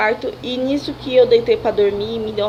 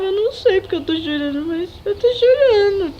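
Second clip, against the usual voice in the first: high, crying-edged voice, slowing at the end; tearful retold answer; 9 semitones higher, 3 dB louder than usual. Answering the nurse through tears, high and teary. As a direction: relive the helpless sobbing reply.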